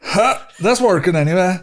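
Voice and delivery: smug clerk mocking the customer; smug gloating. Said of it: "smug fucking head".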